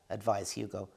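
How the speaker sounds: ordinary delivery, nothing marked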